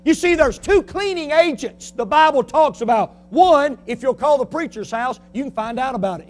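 loud, high preacher's cry; fiery preaching. Acted. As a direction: press the point hard. Preaching at full force.

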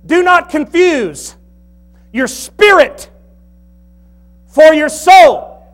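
slow, high, loud pulpit emphasis; emphatic preaching. Acted. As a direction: drive each word home from the pulpit. Preaching at full force.

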